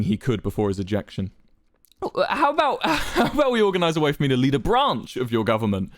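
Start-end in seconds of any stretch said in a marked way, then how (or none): 2.3-5.9 high-pitched nervous pleading; begging. A narrator's reading. Wilbur begging to keep a place in government, pleading. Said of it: "Wilbur seemed to be begging".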